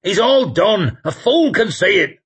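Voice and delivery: high, loud, exasperated yell; shouted. A narrator's reading. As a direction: shout it urgently over the chaos. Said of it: "shouted Sweet".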